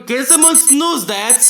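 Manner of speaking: ordinary talk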